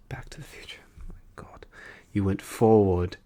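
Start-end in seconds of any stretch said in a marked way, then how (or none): 0.1-1.6 hushed, disbelieving whisper of Back to the future. Acted. Judge repeating the answer to himself, whispered. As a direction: breathe Back to the future under your breath.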